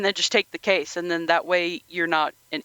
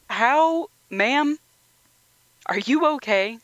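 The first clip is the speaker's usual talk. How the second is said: high, worried asking after her; concerned disbelief; 7 semitones higher, slower than usual. Retold or imitated. Watching Letty get beaten, asking in high concern. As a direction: check on the beaten woman, pitched up. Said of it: "And I'm like".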